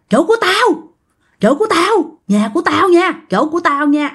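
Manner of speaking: high, insistent acted claim; possessive ghost. Ghostly old woman choking the aunt over her spot, high and insistent.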